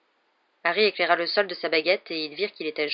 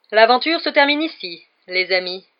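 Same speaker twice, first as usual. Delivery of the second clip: much higher and louder gloating cry; triumphant exclamation; 4 semitones higher, 8 dB louder than usual. A narrator's reading. Lockhart gloats over the boys, triumphant and loud. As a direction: crow it out as the winner. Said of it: "s'exclama-t-il".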